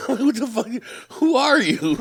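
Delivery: high-pitched, incredulous outburst; retold disbelief. Son astonished at his dad.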